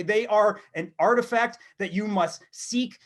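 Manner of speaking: ordinary talk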